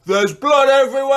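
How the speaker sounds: loud, high, arms-wide cry; dramatic yell. Retold or imitated. Sleeper standing on the bed yelling at a new partner, loud alarmed cry. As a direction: proclaim it to the whole room. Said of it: "threw my arms out wide and yelled".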